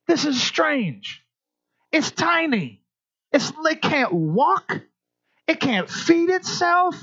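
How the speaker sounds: high, slow, wondering tone; mock amazement. Real, not acted. Preacher marvels to parents at a baby's power, in mock awe.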